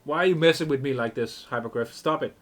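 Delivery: mock exasperation. Real, not acted. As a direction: scold the writer for teasing. Streamer scolds the game writer.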